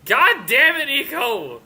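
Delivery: exasperated shout at a teammate; frustrated yell. Real, not acted. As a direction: blow up in real frustration. Frustrated at broken map.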